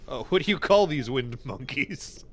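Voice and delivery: ordinary talk, nothing marked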